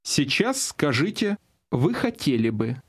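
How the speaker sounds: normal talking voice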